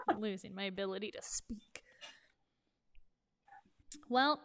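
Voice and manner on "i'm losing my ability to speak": breathless whisper after laughing. Real, not acted.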